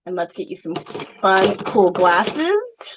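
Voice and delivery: ordinary delivery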